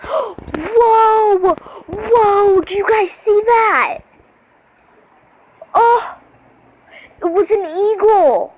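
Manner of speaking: shouting with wonder at the sky; excited. Real, not acted. Vlogger spotting an eagle, loud excited cries. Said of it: "I don't know why I'm talking like this".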